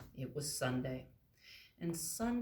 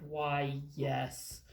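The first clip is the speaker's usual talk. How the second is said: lower, slow kindly elephant voice; lightly deepened friendly giant; gentle elephant; 4 semitones lower, 6 dB louder than usual. Acted. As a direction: reassure the tiny mice. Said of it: "And the elephant said".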